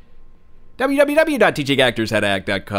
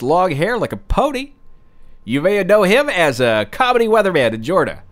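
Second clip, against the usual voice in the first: ordinary talk, nothing marked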